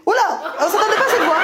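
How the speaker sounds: high, startled reaction; mock surprise. Comic reacting to audience member's unexpected voice, mock surprise. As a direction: react high, taken aback.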